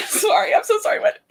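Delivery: high, loud, laughing apology; cracking up. Real, not acted. Reader breaking down over their own botched accent, cracking up. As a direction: apologise through real laughter.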